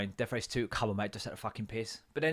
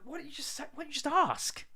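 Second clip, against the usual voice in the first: high mock exasperation. Real, not acted. Mocking the hostage takers.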